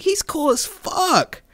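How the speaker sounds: drawn-out high-pitched vouching; retold squealed defence; emphatic defending. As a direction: stretch it out high, insisting he's fine. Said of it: "defending him to my core".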